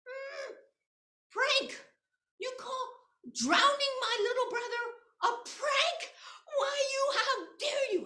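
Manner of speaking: high, loud outraged cry; furious sister confronting her brother's killer; angry girl. Acted. Mariko shouting at the kappa, high and furious. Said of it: "she became so angry".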